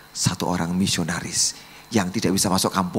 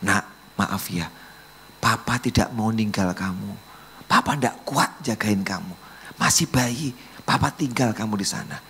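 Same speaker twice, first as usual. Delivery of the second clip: ordinary delivery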